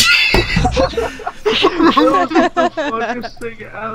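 howling with laughter. Real, not acted. Loud laughing after prank call.

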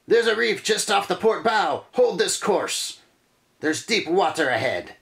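high shouted lookout warning. Acted. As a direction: call it out from the lookout. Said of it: "The lookout yells".

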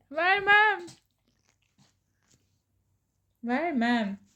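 high playful refusal; talking to a dog. Real, not acted. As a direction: tease the dog, keep the toy. Teasing pet with toy. (köpeğe şakacı ret)

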